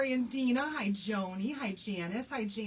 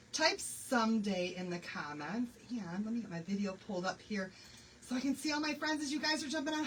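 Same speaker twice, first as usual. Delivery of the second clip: ordinary talk, nothing marked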